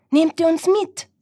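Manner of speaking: high, loud little hedgehog; pleading hedgehog. Acted. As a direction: call out a hopeful request. Hedgehog asks to come along.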